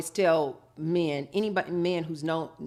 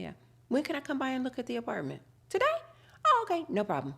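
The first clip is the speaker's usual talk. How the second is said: polite phone inquiry; 7 semitones higher than usual. Retold or imitated. Calling about apartments on the phone.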